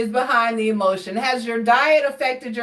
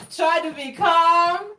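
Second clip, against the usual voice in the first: slow words through laughter, higher; amused, giggling plea; laughing; 6 semitones higher, slower than usual. Real, not acted. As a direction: say it still laughing. Laughing after cuss.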